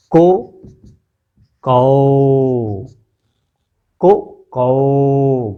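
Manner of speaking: को then कौ drawn out very slowly; कौ held with rounded lips; o and au demo. Shown as a demonstration. Teacher contrasts the vowels for pupils, very slow demo. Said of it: "कंठ और ओष्ठ".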